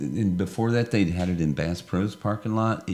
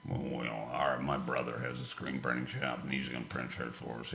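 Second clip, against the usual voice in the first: low, quiet retold quote; lightly voiced other guy; quoting a guy; 7 semitones lower, 13 dB quieter than usual. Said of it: "he's like".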